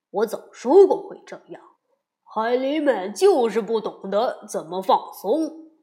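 loud told-you-so father; a hint of smug grumbling; grumbling papa bear.

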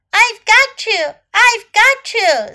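high, loud, triumphant call; shouting. A narrator's reading. Snake has freed little elephant, shouting. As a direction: shout it out as a rescuer. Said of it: "Snake shouted to little elephant".

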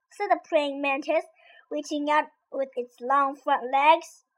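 ordinary delivery

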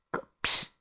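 very soft, whispered dig; hushed dismissive mutter; muttered scorn. Acted. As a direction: let it slip under your breath. Li You's muttered tail of sentence.

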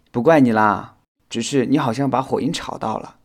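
ordinary delivery, nothing marked

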